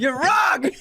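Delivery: high, loud mock outrage. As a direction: act offended, squeaking. Mock indignant retort.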